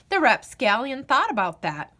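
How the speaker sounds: plain reading voice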